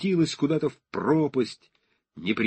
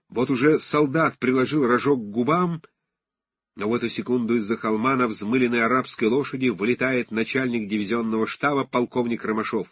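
ordinary delivery

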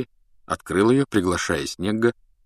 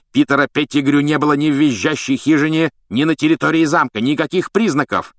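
raised, louder angry argument; angry growl; 7 semitones higher, 5 dB louder than usual. A narrator's reading. Snape refuting the children's story, angry. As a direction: argue with rising anger. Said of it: "зарычал Снег".

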